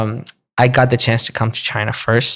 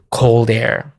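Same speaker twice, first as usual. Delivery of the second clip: ordinary delivery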